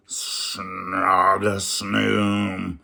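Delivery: drawn-out snoring noise; a long sleepy rumbling snore; slow snore sound. Acted. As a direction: snore it slowly. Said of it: "From Beauty's room came".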